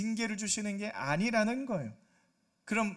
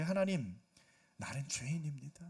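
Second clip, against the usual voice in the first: whispered, softer confession of sin; broken confession; 4 semitones lower, 4 dB quieter than usual. Acted. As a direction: breathe it out as a humbled sinner. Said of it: "어떤 고백을 해?". (상한 심령으로 속삭이는 죄 고백)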